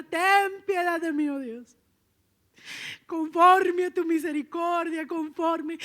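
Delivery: loud, drawn-out plea for mercy; David pleading. Retold or imitated. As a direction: cry out to God as a penitent king. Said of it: "David se tira por allá y empieza".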